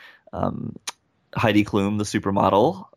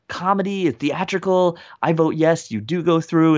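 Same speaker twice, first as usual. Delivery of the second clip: Howie Mandel; 7 semitones higher than usual. Retold or imitated. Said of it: "Howie Mandel's like".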